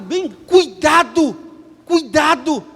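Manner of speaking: slow, high, loud warning of "cuidado"; urgent warning. Acted. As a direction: draw it out like a danger sign. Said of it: "Ó o cuidado". (alerta lento e forte)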